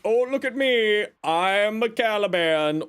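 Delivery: mock show-off voice. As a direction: parody a bragging player. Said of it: "Everyone's gonna be like".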